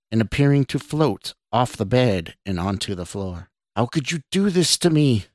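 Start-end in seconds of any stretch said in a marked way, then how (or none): 3.8-5.3 higher-pitched, wronged cry; anguished cry. A narrator's reading. Floating woman accuses Scott, anguished cry. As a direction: cry out the accusation without much volume. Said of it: "the woman cried".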